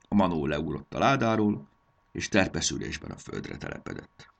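usual speaking voice

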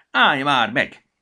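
loud shout to stop; loud cry of stop. Acted. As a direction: yell at someone running off. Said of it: "kiáltotta Petya".

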